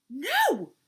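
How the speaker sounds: loud, high yelled refusal. A narrator's reading. Vulture refuses Turtle, yelling. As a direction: yell it out sharply at full voice. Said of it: "yelled Vulture".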